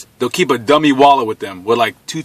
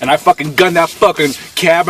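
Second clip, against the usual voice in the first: ordinary talk, nothing marked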